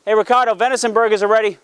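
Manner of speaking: calling out from the grill; barbecue dad. Real, not acted. Dad calling son to dinner.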